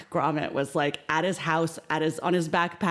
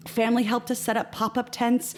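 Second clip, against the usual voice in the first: ordinary talk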